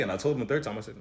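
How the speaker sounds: usual speaking voice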